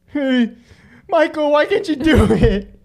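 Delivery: high, put-on voice with laughter creeping in; mock mirror practice. Friend acting out practising in the mirror, high mock voice.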